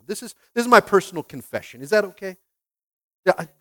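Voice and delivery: normal talking voice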